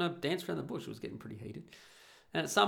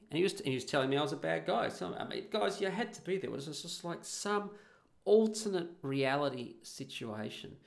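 ordinary talk, nothing marked

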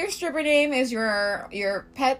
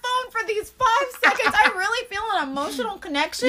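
much higher, gushing rep voice; mock clingy rep; 7 semitones higher than usual. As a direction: gush like an overeager rep. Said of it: "I'm just so sad".

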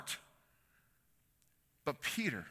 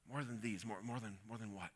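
musing softly; 6 semitones lower, 13 dB quieter than usual. Real, not acted. Preacher mulling the question.

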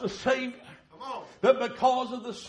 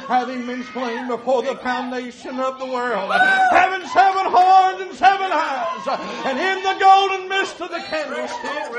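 full-force preaching; 2 semitones higher, 8 dB louder than usual. Acted. Preacher to congregation, describing the Lamb in a rising shout. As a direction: lift the vision into a shout.

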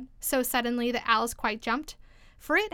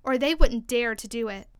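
usual speaking voice

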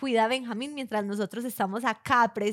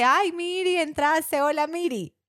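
mock greeting; 6 semitones higher, 5 dB louder, slower than usual. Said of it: "la grúa dice".

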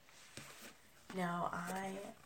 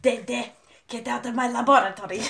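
lightly voiced cartoon boy accent, laughing; a touch of Dexter scolding his sister; Dexter impression; 3 semitones higher than usual. Scolding Dee Dee, laughing Dexter accent. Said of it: "Dexter's Lab".

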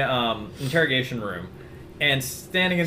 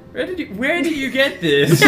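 higher, laughing pompous Duke; the Duke's voice; 10 semitones higher than usual. Pretend. Duke questioning the prisoner about the sword, higher put-on voice. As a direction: raise the pitch into a preening nobleman. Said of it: "I need to do the Duke's voice".

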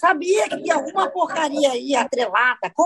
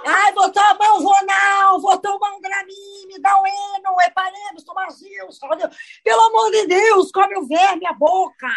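dramatic panic; 5 semitones higher than usual. Pretend. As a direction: wail it like you're about to faint. Friend mock-panics at worm talk.

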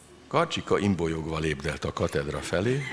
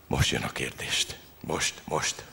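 zöngétlen, halk suttogás; súgd magadnak, drukkolva; izgult suttogás; 3 semitones lower, slower than usual. Acted. Karcsika belső izgulása. (izgatott belső suttogás)

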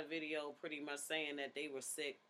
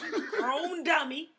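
laughing; 12 semitones higher, 12 dB louder than usual. Real, not acted. Laughing through the outro.

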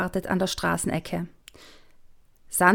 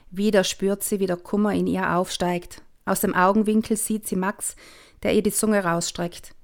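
plain reading voice

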